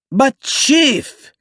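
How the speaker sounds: high, loud shrill whine; shrill complaint. A narrator's reading. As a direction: protest in a shrill lisp. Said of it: "complained Tonio".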